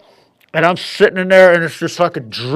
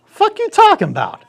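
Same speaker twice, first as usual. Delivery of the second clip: much higher, incredulous squeak; baffled outburst. Retold or imitated. Man told to turn over, high incredulous outburst. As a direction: act floored by the request.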